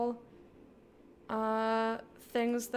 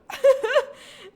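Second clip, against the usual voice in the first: loud shrieking laugh; 12 dB louder than usual. Real, not acted.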